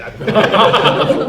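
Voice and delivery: laughing. Real, not acted. Laughing while confessing broken fan.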